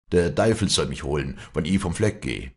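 ordinary delivery

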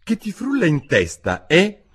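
high-pitched cross questioning; scold, ending on a loud demanding jab; stern. A narrator's reading. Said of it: "disse il topo ad Alice severamente".

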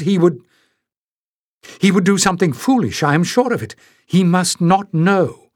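put-on a flustered young girl protecting the man she loves; flustered protest. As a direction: defend him in flustered agitation. Flustered girl defends Raynesh.

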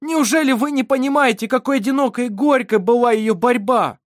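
loud, high cry of helpless anguish; nearly sobbing. A narrator's reading. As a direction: cry out in helpless despair, close to sobs. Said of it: "Даниэль почти рыдал от бессилия". (отчаянный крик, почти рыдание)

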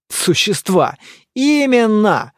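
mocking echo, rising into a loud exclamation; scornful snort. A narrator's reading. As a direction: snort the word back derisively. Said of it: "фыркнул Добродеев".

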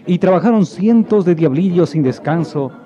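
usual speaking voice

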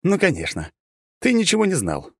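high-pitched mock agreement; scoffing. A narrator's reading. Hero mocking god's claim of ignorance, scoffing. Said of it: "усмехнулся я".

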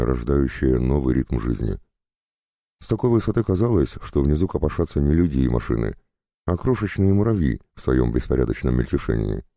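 usual speaking voice

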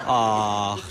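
low, different voice for the wife's sigh; a lightly voiced wife melting for the bear; soft-hearted wife. Wife pities the hungry bear, low sigh. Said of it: "zei mevrouw".

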